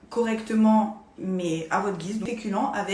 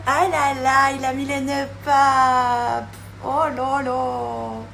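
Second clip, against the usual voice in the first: delighted; 5 semitones higher, 9 dB louder, much slower than usual. Real, not acted. Reacting to the food, delighted.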